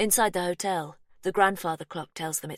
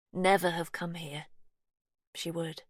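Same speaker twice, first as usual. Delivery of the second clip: usual speaking voice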